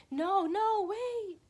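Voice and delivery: high, alarmed no, no, wait; panicked voice. Retold or imitated. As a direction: cry out in sudden alarm. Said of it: "my head is like".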